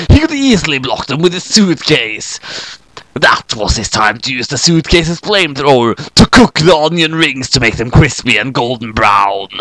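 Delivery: plain reading voice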